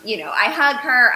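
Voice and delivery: normal talking voice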